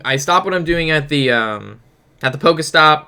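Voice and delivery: normal talking voice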